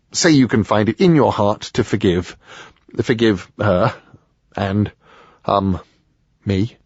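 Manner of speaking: hesitant, with long gaps between words; halting apology. Acted. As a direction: grope for the words as you ask forgiveness. Man awkwardly asks mother's forgiveness.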